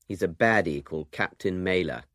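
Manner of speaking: ordinary talk, nothing marked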